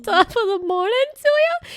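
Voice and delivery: high, loud, lilting mock Irish; Irish accent pretending to be near tears; sing-song Irish greeting, hammed-up; emotional Irish accent. Shown as a demonstration. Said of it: "Just pretend like you're about to cry".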